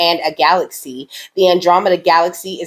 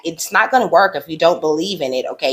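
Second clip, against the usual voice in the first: ordinary talk, nothing marked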